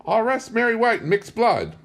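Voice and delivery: put-on high, loud frail old woman voice; old tribeswoman. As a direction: speak as the last of her tribe, high and old. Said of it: "she concluded".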